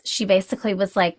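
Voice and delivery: ordinary delivery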